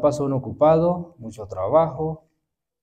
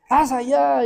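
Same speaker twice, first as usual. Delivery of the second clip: high, loud commanding calls to the family; bossy shouting mother; 12 semitones higher, 4 dB louder, slower than usual. Retold or imitated. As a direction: bark orders like a fussy mother shooing the hens. Mother shooing someone away.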